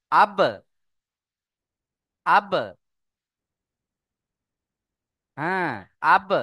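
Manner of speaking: আব with the ব bounced off sharply; qalqala bounce. Shown as a demonstration. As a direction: give the ব in আব a short echoing knock. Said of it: "এইভাবে পড়তে হবে, ধাক্কা দিতে হবে".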